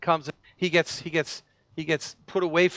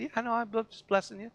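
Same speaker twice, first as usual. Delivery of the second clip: playful brush-off; 4 semitones higher, 7 dB quieter, faster than usual. Retold or imitated. Pastor retells his cheerful reply.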